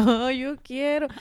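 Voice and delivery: slow, whiny yearning; a touch of wistful whining; mock longing. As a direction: pine like a kid left out. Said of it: "Y yo".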